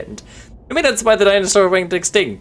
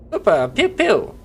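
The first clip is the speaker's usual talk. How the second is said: playful pew pew gun sounds, higher; mock gunshots; 6 semitones higher, slower than usual. Streamer playing gunslinger, making playful pew pew gunshots. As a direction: fire off pretend shots with your mouth.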